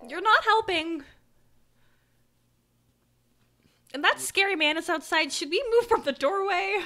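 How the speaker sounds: put-on high, loud kid voice; high-pitched, insistent child; worried child. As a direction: pipe up like an anxious little boy. GM voices boy scolding the adult.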